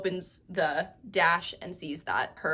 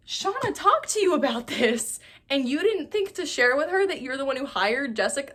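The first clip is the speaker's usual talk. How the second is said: high-pitched incredulous scolding; indignant disbelief; 6 semitones higher than usual. Acted. Recapper scolding a character over a secret, indignant disbelief. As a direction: call them out in outraged disbelief.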